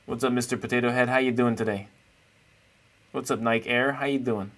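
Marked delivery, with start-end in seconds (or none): none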